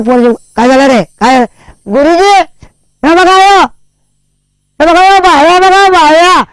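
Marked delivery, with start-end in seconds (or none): 1.9-6.4 put-on high, pleading kid's voice; high kid voice complaining about torn clothes; whiny child complaint. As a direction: whine to the teacher like a wronged child.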